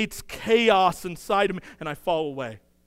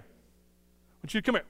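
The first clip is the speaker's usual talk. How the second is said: gentle, softer invitation, said tenderly; lovingly; 6 dB quieter than usual. Retold or imitated. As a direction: coax him over softly, with love. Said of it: "he lovingly comes over to you and says".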